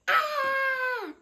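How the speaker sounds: high loud scream of fright; high yelp of fright; startled scream. Acted. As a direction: shriek like you've been scared. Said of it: "His father turned around and yelled".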